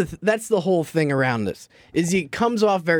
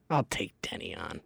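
whisper; 10 semitones lower, 12 dB quieter than usual. Real, not acted.

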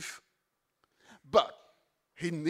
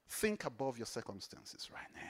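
hushed, breathy urging; whisper; 8 semitones lower, 11 dB quieter than usual. Acted. Preacher daring listeners in a whisper. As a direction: lean in and whisper the dare. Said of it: "I dare you".

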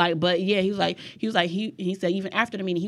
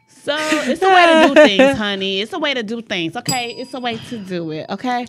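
playful sass; 4 semitones higher, 8 dB louder than usual. Pretend. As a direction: start loud and high, then ease off. Mock lesson to laughing co-host.

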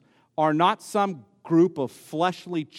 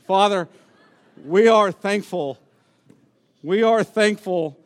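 closing prayer. Real, not acted. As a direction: pray it aloud for the congregation.

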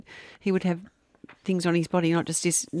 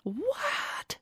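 incredulous; 12 semitones higher, 10 dB quieter than usual. Real, not acted. Disbelief at million dollar bill.

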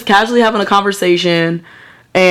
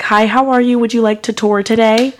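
higher, polite tour-greeter voice; little work voice; 4 semitones higher than usual. Shown as a demonstration. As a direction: put on a little voice for customers. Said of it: "I turn on my little voice".

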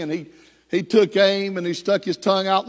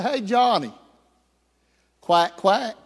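lightly voiced teasing little sister; sing-song reminder that she knows; teasing taunt; 3 semitones higher than usual. Sister taunts brother about duck.

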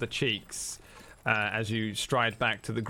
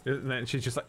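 usual speaking voice

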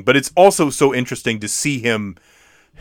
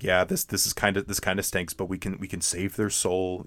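low, soft voice of reluctance; subdued reluctance; 5 semitones lower, 12 dB quieter than usual. Acted. Tim reluctantly agrees to save their soul, subdued. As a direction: play it down, quiet and low.

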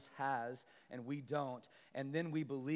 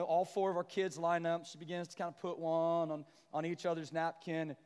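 normal talking voice

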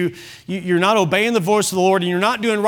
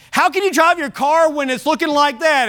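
higher, disbelieving question; incredulous question; 8 semitones higher than usual. Retold or imitated. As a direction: ask it in amazed disbelief. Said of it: "he asked this".

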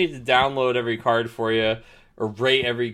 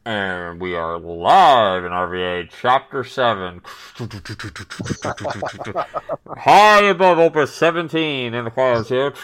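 news reporter; 4 dB louder than usual. Pretend. Mock live news report.

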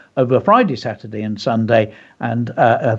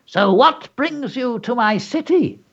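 a higher, slow put-on goblin voice, menacing; growly goblin; 11 semitones higher than usual. A narrator's reading. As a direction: let the goblin toy with his captive, slowly. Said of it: "the goblin said in a growly voice".

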